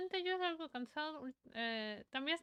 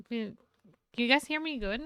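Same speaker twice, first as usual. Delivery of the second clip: normal talking voice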